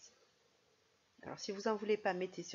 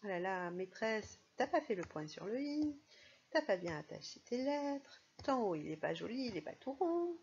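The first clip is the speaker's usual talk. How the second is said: playful schoolchild nitpicking, pitched up; teasing pupils; 3 semitones higher than usual. Acted. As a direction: mimic children picking on the teacher's handwriting. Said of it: "ça vous amuse beaucoup de voir la maîtresse écrire aussi mal".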